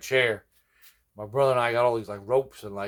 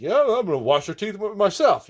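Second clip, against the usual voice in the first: higher, bright salesman-like promise; imitating the dentist; 10 semitones higher, 6 dB louder than usual. A dentist in a Superman costume charms an old lady patient. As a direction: lay on the charm. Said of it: "he was like".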